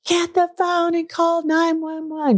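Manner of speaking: high, urgent yell for help; retold scream. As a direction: shout it at your sister in panic. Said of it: "she screamed".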